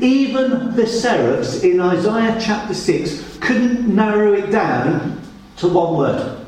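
ordinary delivery

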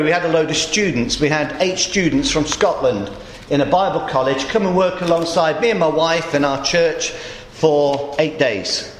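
usual speaking voice

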